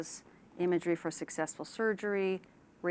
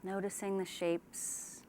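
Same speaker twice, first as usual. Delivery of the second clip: unhurried relaxation-exercise delivery; guided meditation; 4 dB quieter than usual. Shown as a demonstration. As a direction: ease them gently into calm, leaving space after each phrase. Leads a guided imagery relaxation.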